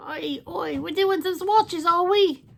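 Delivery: put-on high, loud cheeky British accent; a hammed-up Cockney "oi oi"; bad British accent. Vlogger joking to viewers in a bad British accent. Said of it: "my bad accents".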